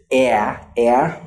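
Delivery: drawn-out "eh" as in the name Bran; ash vowel sound. Shown as a demonstration. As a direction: hold "eh" as an open front vowel. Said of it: "This is".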